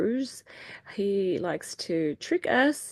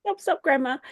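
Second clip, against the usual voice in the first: stopping grandma; 8 semitones higher, 8 dB louder than usual. Retold or imitated. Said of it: "And I was like".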